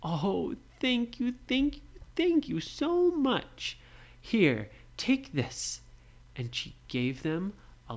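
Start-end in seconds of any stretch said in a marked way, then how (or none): 0.0-5.8 high, slow thankful granny voice; grateful old lady. Acted. Old lady thanking the children, high and gushing. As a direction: gush thanks like a frail old woman. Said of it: "she said".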